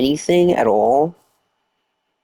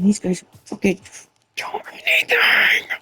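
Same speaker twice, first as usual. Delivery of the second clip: whisper-yell; 6 semitones higher than usual. Real, not acted.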